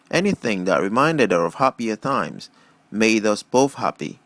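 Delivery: plain reading voice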